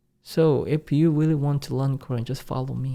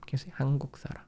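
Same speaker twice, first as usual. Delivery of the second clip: whispered; 8 dB quieter, much faster than usual. Real, not acted. Prompting learners softly.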